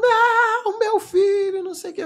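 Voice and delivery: high mock wail that fades; wailing family. Family running up shrieking over their son, high mocking wail. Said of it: "veio todo gritando".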